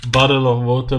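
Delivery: loud, high mock British "Bottle of water", with a crisp t in "Bottle"; overdone posh British "Bottle of water"; British accent. Shown as a demonstration. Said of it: "British accent".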